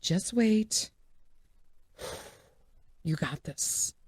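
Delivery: soft, restrained inner voice; hushed self-soothing. Acted. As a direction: talk your angry side down quietly. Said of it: "And I'm like".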